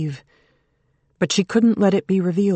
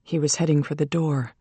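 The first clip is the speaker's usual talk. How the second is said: ordinary delivery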